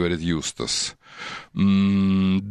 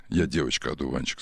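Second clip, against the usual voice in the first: deep voice; 6 semitones lower, 5 dB quieter than usual. Shown as a demonstration. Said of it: "Басом скажи так".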